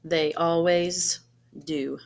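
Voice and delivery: animated picture-book narration with gentle pauses; storybook reading. Acted. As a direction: read it like story time for a class. Reads picture book to students.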